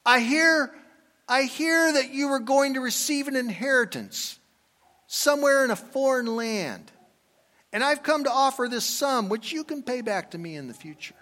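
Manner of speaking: high, lightly voiced gentle rabbi offering a loan; a rabbi sparing a poor man shame, high and kind; Rav Jonah's offer. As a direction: lift the voice high and kindly for the rabbi. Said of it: "says that he said this".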